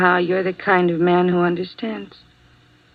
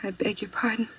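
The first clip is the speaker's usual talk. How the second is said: barely voiced, caught off guard; soft disbelief, as if you misheard; startled whisper; 3 semitones higher, 9 dB quieter than usual. Nurse startled by the lawyer's offer.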